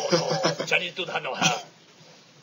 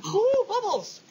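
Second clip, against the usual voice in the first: high delighted cry, much higher and louder; excited squeal; 3 dB louder, much slower than usual. Real, not acted. Cohost spots bubbles on screen and squeals with delight.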